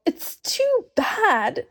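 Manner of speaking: high, slow, breaking sob; sobbing. A narrator's reading. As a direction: cry the words out in grief. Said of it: "sobbed Lucy".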